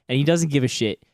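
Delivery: usual speaking voice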